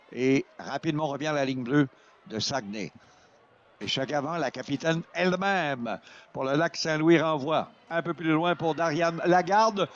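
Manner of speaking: ordinary delivery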